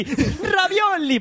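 very slow, high, laughing fake Italian; drawn-out Italian. Host repeating the food cry in a slow, laughing Italian drawl. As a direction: stretch it out while laughing. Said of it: "con acento italiano y haciendo coña".

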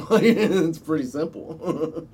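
slow, laughing through the words. Real, not acted. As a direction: chuckle while you say it. Laughing at his plan.

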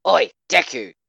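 loud, high call across the room. A narrator's reading. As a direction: shout his name from the doorway. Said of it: "Katsuki called".

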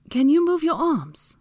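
high, slow, loud question; female character voice. A narrator's reading. Hermione asking wedged Draco, in high voice. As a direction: pitch it up and ask pointedly.

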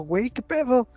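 half-acted movie panic; mock panic. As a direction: play the scared viewer blurting out. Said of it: "yo estaba así bien paniqueado, le digo".

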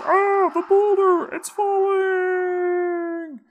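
very high, loud, drawn-out wail; panicked cry. Acted. Villager screaming as the boulder falls, very high. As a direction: cry out in terror, stretched long. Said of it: "And somebody says".

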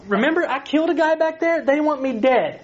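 high-pitched whiny objection; panicked protest. Acted. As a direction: squeak out the excuse in alarm. Moses protesting to God.

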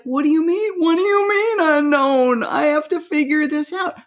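high, puzzled voice as the anxious mind; the mind panicking. Acted. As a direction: play the mind, baffled by the unknown. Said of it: "It's like".

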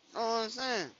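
ordinary talk, nothing marked

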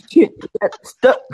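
ordinary talk

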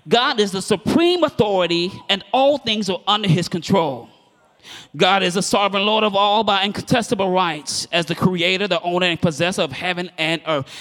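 rising preacher's declaration; emphatic re-reading. Real, not acted. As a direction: proclaim the definition to the congregation. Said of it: "I'm gonna read that again".

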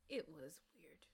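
a soft, voiceless aside; whispered. Real, not acted. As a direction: whisper it like a secret. Whispered aside about the dad.

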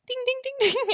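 high pinball ding noises; ding like a pinball machine. Retold or imitated. Host mocking her own rambling as pinball dings, high. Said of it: "a pinball machine".

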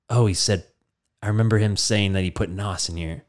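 mock-dramatic hushed realization; quiet recollection. Vin Diesel remembers Mark's advice mid-race, hushed mock voice.

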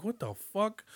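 high, soft baffled exclamation; mock confused, high pitch; retold surprise. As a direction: catch yourself in the mistake. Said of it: "I'm like".

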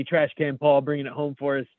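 normal talking voice

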